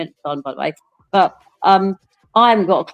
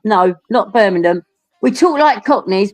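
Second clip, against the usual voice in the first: ordinary talk, nothing marked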